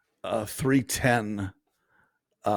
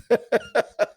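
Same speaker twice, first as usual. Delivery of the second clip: high burst of laughter; 12 semitones higher, 3 dB louder than usual. Real, not acted. Laughing after the story.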